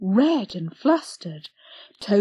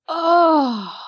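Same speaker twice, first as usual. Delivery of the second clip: plain reading voice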